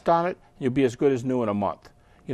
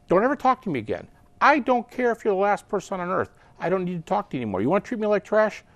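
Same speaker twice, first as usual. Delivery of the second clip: emotional outburst; 7 semitones higher, faster than usual. Acted. Dating partner cuts the other off, indignant and higher. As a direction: act out a fed-up lover's tirade. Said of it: "when you're emotional for a long time".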